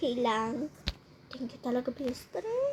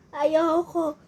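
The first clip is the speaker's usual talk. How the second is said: usual speaking voice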